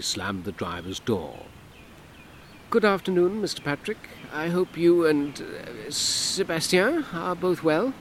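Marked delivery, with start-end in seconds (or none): 2.7-7.9 higher, louder guarded civil servant; stiffly polite woman; Hortense, cool. Acted. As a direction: keep him at arm's length, politely. Narrator voices Hortense.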